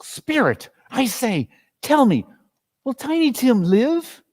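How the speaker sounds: high, anxious plea; pleading Scrooge. Acted. Scrooge begging the ghost about Tiny Tim. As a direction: beg the spirit for an answer.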